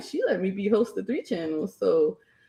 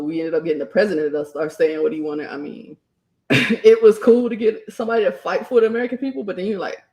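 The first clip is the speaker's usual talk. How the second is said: ordinary delivery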